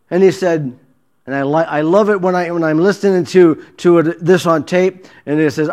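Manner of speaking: ordinary talk, nothing marked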